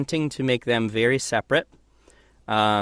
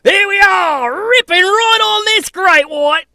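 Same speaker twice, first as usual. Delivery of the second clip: huge high shout, Aussie adventurer; shark-wrestling Australian daredevil at full yell; Manitoba Mike; 11 dB louder, slower than usual. Acted. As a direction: bellow it drawn out, wildly over the top. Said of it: "It's more like".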